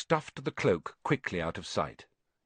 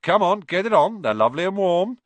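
loud, high-pitched cajoling; boisterous urging; 8 semitones higher, 9 dB louder than usual. A narrator's reading. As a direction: badger a brother into joining in. Brother badgers Ron to wear jumper.

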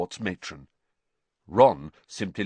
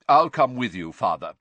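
louder, puffed-up offer to help; self-important; 3 semitones higher, 7 dB louder than usual. Acted. Percy eagerly volunteering to join his father, pompous. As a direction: sound very important. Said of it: "said Percy importantly".

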